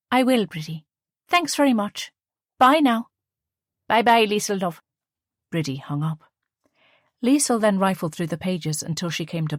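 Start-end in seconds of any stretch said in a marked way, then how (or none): 0.1-3.1 higher, quick thankful goodbye; lightly voiced young woman. A narrator's reading. Liesl voiced in dialogue. 3.9-4.8 higher, fond northern Irish goodbye; northern Irish accent. A narrator's reading. Bridie voiced in dialogue.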